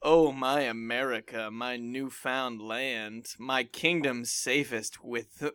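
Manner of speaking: seduce the listener slowly; sultry. Pretend. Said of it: "get as sultry as you can".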